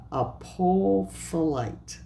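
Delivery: apophyllite slowly sounded out, drawn long; careful pronunciation. Real, not acted. Tarot reader trying a hard crystal name, careful pronunciation of "apophyllite". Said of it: "I have a hard time pronouncing".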